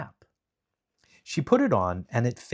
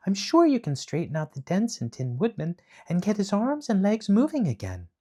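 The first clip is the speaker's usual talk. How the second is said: put-on girl voice; 10 semitones higher than usual. A narrator's reading. Dorothy voiced in read story.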